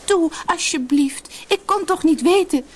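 high-pitched begging; calling out pleadingly. A narrator's reading. Sina begs the silent sisters, pleading high. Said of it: "riep Sina".

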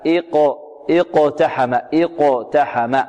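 "اقتحم" begun with a clear glottal "i"; hamza sounded at start. Shown as a demonstration. Teacher shows starting on the word, "اقتحم" with audible initial hamza. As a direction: start "اقتحم" on i-, then bounce the qaf. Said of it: "لو ابتدأنا بكلمة اقتحم هكذا".

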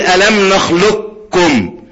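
"نخلقكم" with qaf fully merged into a doubled kaf; complete idgham. Shown as a demonstration. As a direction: recite slowly, no trace of qaf in "نخلقكم". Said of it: "ما عندوش غير إدغام كامل بس".

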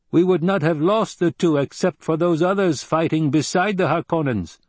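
high-pitched, matter-of-fact tribesman voice; Fremen desert fighter, blunt. A narrator's reading.

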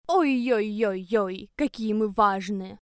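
slow, drawn-out mocking tease. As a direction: stretch it out like a clowning drunk. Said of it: "кривляясь как обезьяна".

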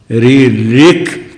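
रि, ऋक very slow, ending on a crisp क; final क sounded. Shown as a demonstration. Teacher modelling the sutra, रि, ऋक slow and loud-edged with clear क. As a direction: stretch रि and ऋक apart and release the क. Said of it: "क उच्चारण होना चाहिए".

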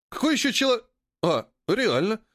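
high dismissive start, breaking off in surprise; startled young trader's high voice; surprised. A narrator's reading. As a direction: begin incredulous and high, then register it. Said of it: "удивился Поганка".

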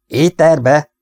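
high, loud startled question; shocked echo. A narrator's reading. Siegfried echoes James's confession about the ether, aghast. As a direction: echo it back in disbelief.

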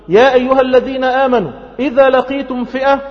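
high, loud declamation; rousing call. Acted. God calls believers facing an enemy, rousing call. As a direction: summon the believers at full voice.

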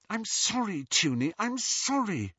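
pleading apology. Acted. Lily pleads with her sister on the platform, high voice. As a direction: beg her sister's forgiveness.

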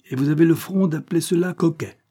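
high-pitched indignant exclamation; exasperated cry. A narrator's reading. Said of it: "s'écria Cornélie avec exaspération". (exclamation exaspérée)

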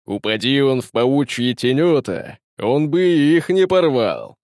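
loud, growling tyrant's jest; guttural growl. A narrator's reading. The Tyrant mocks skinny Matamore, growling with amusement. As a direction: growl it out loudly from the gut. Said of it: "утробно порыкивая".

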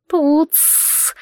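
sharp little snake hiss; hissing serpent dragon. Acted. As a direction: spit it out like a tiny serpent. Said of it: "прошипели у дивана".

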